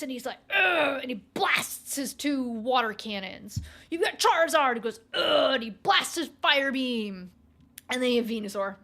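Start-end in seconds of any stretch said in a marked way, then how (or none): 0.5-1.0 high, loud powered-up grunt; loud cartoon battle grunt; effort grunt. Blastoise firing cannons. 3.9-4.7 louder, higher hype; loud excited introduction; hyped intro. Acted. Narrating Pokemon intro. 5.1-5.5 high, loud powered-up grunt; loud cartoon battle grunt; effort grunt. Charizard firing beam.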